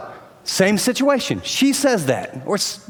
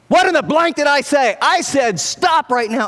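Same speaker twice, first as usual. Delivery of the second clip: higher, louder angry bark; exploding parent; 8 semitones higher, 4 dB louder than usual. Retold or imitated. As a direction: blow up at the kids. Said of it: "embarrasses you in front of your friends".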